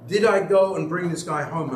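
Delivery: usual speaking voice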